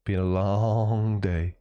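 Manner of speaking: slow, drawn-out tired echo; weary repeat. Real, not acted. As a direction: let long stretch out wearily. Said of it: "I need to lean on my desk".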